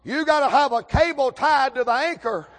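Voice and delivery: high, loud pulpit delivery; ringing, hollered sermon point; full-force preaching. Real, not acted. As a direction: drive the lesson home, slowing on key phrases.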